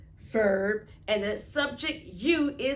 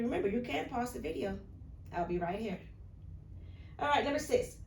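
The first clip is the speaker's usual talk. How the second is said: usual speaking voice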